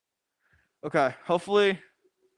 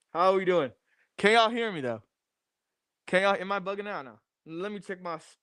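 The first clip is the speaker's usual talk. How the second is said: ordinary talk, nothing marked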